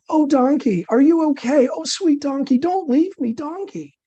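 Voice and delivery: high, pleading wife crying over her donkey; dramatic worried wife. Acted. Wife begging her fainted donkey, high and dramatic. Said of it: "with less dramatic effect than the wife".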